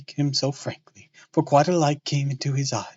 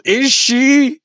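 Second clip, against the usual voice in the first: incredulous; 12 semitones higher, 11 dB louder than usual. Real, not acted. Mocking reader comment.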